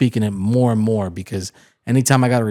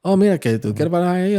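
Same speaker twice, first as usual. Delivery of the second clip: mock Chicano accent, higher pitched; Chicano Spanish imitation; 7 semitones higher than usual. Imitating locals who only sound like Spanish speakers. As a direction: ham up a Chicano Spanish line. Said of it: "'Cause they'll go".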